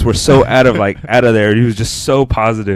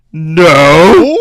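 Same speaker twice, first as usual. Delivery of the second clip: mock cry; 7 semitones higher, 9 dB louder than usual. Mock wail after quote.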